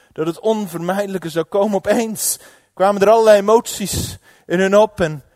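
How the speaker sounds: loud, urgent storytelling; dramatic preaching narration. A narrator's reading. Dramatisch vertelde climax. (luid, dramatisch verteld)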